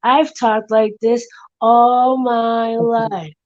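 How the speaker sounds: exaggerated drawn-out sarcasm; patronizing, sarcastic. Second guy excuses his tone to Derek, sarcastic. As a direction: stretch each word as if it were an impediment. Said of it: "patronizing and sarcastically".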